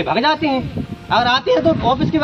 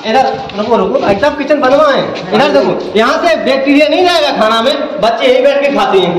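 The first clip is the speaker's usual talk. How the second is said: louder, urgent, showing the dirt; loud heated complaint; 6 dB louder than usual. Real, not acted. Villager loudly showing the reporter the kitchen dirt. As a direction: make them look at the filth.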